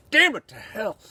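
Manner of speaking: sudden loud, high curse; angry outburst. Acted. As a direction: burst out cursing in frustration. Bitter rant, sudden outburst.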